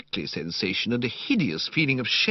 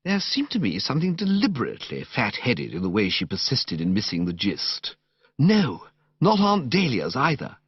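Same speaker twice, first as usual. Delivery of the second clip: plain reading voice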